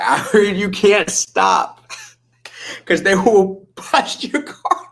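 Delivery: high, slow, laughing through the words; giggling as she says it. Real, not acted. Laughing while retelling a rumour.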